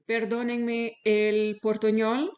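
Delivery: ordinary talk, nothing marked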